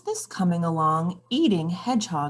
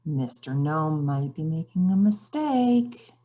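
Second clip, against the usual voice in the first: ordinary delivery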